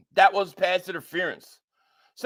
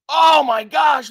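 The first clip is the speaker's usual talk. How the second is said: louder, slow "oh my gosh" in disbelief; shocked outcry; 4 semitones higher, 7 dB louder, slower than usual. Real, not acted. Fan reacting to uncalled penalty on stream, shocked outcry. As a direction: cry out at the no-call.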